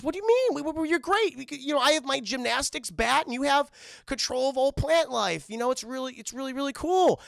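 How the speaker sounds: high, loud, bubbly coaxing; Harley begging Ivy to team up, high and eager; eager pleading. Retold or imitated. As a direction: gush hopefully at a friend.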